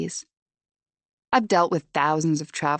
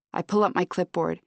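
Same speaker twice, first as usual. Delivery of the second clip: plain reading voice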